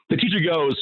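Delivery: usual speaking voice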